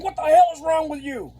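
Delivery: high, loud angry yell; angry yell at a disrespectful boy; retold yell. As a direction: bark it at the kid. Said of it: "I start yelling at this kid".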